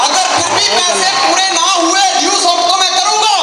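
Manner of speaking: loud, high defiant outburst at the father-in-law; angry defiance. Retold or imitated. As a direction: throw the vow back in his face, shouting. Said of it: "बड़ा गुस्सा आया".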